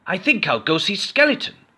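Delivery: high, eager little goblin; chirpy goblin planning his day. A narrator's reading. Goblin to himself, high and eager. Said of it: "said Goblin".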